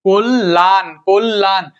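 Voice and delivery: কল্যাণ in two beats, "kol-lan"; doubled l. Shown as a demonstration. Teacher drilling the rule, কল্যাণ as "kol-lan" with doubled l. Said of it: "দুইবার উচ্চারণ হবে".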